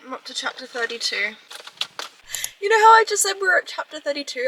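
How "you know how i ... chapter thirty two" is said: fast, high and loud, wound up; excited outburst. Real, not acted. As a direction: blurt it out, worked up. Excited outburst after reading twist.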